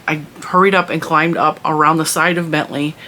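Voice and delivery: ordinary talk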